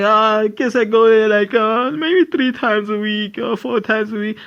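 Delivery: lightly voiced mom; lady's voice.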